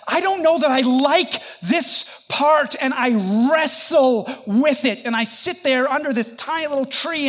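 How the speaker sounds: normal talking voice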